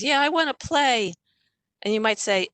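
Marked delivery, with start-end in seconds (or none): 0.0-1.1 put-on high, childlike whine; kid's whine. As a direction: sound like a bored child. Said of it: "your kid says".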